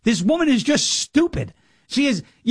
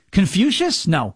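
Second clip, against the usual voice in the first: ordinary delivery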